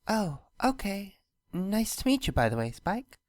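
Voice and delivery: a shy, pleased princess greeting; Luna voice. A narrator's reading. Luna greets Spike, lightly voiced and shy.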